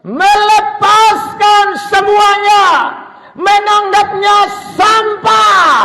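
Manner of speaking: high, slow, drawn-out proclamation; preacher's shout. Acted. Preacher crying out Paul's renunciation to the congregation, shouted preaching. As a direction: stretch each word out at full force.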